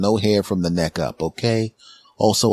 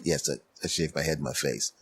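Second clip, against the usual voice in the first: soft, low reply; 5 semitones lower, 10 dB quieter than usual. Retold or imitated.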